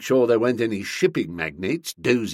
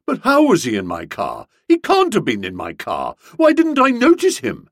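louder protest, leaping high at the end; aghast disbelief; 6 semitones higher, 6 dB louder than usual. A narrator's reading. Boko learns Uncle Percy was in his car, aghast. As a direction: splutter out stunned questions.

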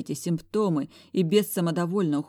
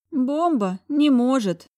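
slow, high, drawn-out musing; thoughtful drawl; 8 semitones higher, 7 dB louder, slower than usual. A narrator's reading. Lady muses over the bomb saying, slowly drawn out. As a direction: stretch the words out thoughtfully. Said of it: "задумчиво протянула дама".

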